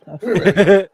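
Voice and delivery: loud, laughing call to the dog. Real, not acted. Owner calling her hiding dog, laughing and loud.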